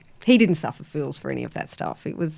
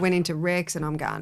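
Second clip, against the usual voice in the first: normal talking voice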